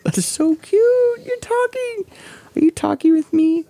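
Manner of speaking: high cooing voice to a dog. Real, not acted. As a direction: coo at the puppy. Talking to the dog.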